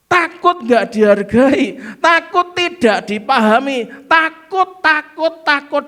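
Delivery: loud, high preaching build-up; fiery preaching. Acted. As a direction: preach it hard to the crowd. Preaching at full force.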